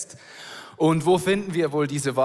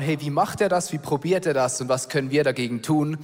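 ordinary talk

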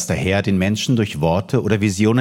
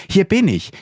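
put-on boy's voice, much higher and a bit louder; young boy; 13 semitones higher, 3 dB louder than usual. Young Samuel answers the call, eager high boy's voice. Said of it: "der Junge zu Eli und sagte".

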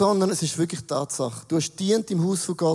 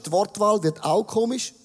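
usual speaking voice

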